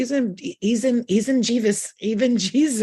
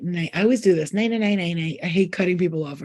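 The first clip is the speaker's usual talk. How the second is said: ordinary talk, nothing marked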